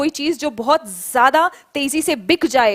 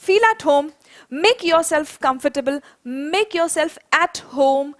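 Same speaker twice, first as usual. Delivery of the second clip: slow model phrase; much slower than usual. Shown as a demonstration. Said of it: "तो आप क्या कहेंगे".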